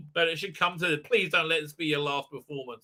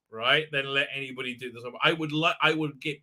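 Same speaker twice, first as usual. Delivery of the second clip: usual speaking voice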